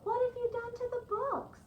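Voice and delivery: high, shocked question; astonished. Acted. Missus Page, astonished at the dressed books, asks high. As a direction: gasp out the question in disbelief. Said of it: "Missus Page was astonished".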